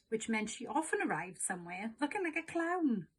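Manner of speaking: ordinary delivery, nothing marked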